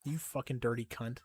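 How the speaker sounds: normal talking voice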